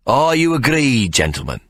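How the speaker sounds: high bright call to the captains; Ikey, showman call. Acted. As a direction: lift it like a barker asking.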